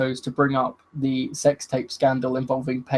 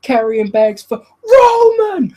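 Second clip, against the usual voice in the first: high, loud, slow shouted punchline; 11 semitones higher, 9 dB louder, slower than usual. Retold or imitated. Xavier Woods finishing his rap, with a high slow shout. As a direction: bellow the punchline to the crowd. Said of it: "And he goes".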